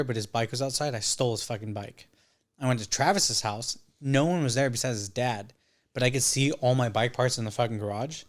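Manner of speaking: ordinary talk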